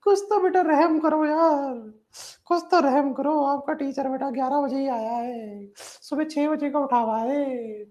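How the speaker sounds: hammed-up plaintive wail; mock pleading. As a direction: beg for mercy in a put-on high voice. Mock plea to students for mercy.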